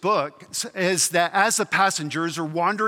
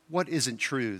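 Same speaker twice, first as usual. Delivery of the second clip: low, soft sulky ghost; 5 semitones lower, 7 dB quieter than usual. A narrator's reading. Ghost challenged by heavenly friend, replying sulkily. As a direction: grumble the question quietly, in a sulk. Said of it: "the ghost said sulkily".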